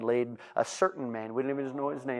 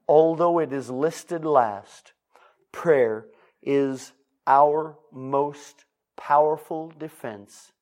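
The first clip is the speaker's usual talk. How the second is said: very slow, deliberate louder words; slow heavy preacher emphasis; 3 semitones higher, 8 dB louder, much slower than usual. Acted. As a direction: lean on every word. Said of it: "slow emphatic delivery".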